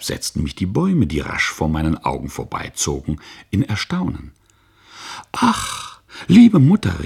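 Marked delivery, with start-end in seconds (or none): 5.3-7.0 high, loud astonished cry; a child calling out to his mother in wonder; child's loud cry of amazement. Acted. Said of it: "rief ich".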